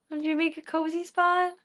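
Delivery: squeaky tender cat talk. Real, not acted. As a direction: coo the question to the kitten.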